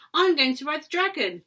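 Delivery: high, loud eager cry; excited child. Acted. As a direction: call out like a thrilled kid. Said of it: "cried one".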